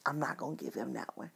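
a quick whispered decision to herself. Retold or imitated. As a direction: hush it to yourself at the pan. Private thought at the stove.

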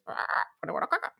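high squawking parrot. Retold or imitated. Parrot voice in joke.

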